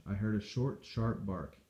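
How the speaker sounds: ordinary delivery, nothing marked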